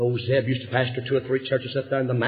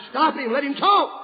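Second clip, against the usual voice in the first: pleading family; 11 semitones higher than usual. Retold or imitated. Family insisting to the doctor.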